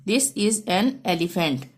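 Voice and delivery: very slow, each word spaced out; slow dictation. Shown as a demonstration. Teacher reading an example sentence to pupils, slow and clear. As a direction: read it out slowly for the class.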